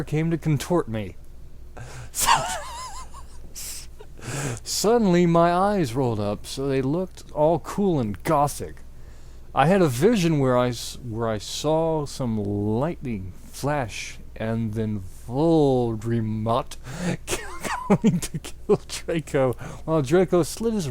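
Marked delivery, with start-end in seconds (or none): 1.2-4.6 real high wheezing laugh; real giggling fit; high squealing laugh. Real, not acted. Reader laughing. 17.1-19.5 real giggle over the words; laughing through words. Real, not acted. Reader laughing.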